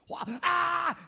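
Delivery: high, loud frightened cry of Why, Ah; scream in a scary movie. Retold or imitated. Pastor replaying his own yelling during a movie, high loud shriek. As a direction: shriek at the screen. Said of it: "I talk in movies".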